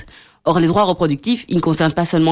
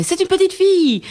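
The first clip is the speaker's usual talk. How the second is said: voix aiguë qui s'exclame, dernière syllabe tenue; annonce de naissance imitée; 13 semitones higher than usual. Retold or imitated. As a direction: imiter l'annonce ravie « c'est une fille » à la naissance, très haut perché. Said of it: "ils ont fait". (exclamation aiguë imitée)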